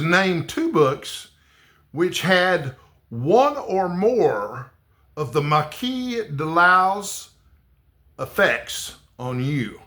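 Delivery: very slow, careful read-out; reading the prompt. Acted. Vlogger reads the puzzling prompt to viewers, slow and careful. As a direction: spell out each phrase of the question slowly.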